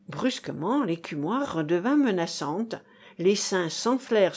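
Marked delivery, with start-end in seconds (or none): none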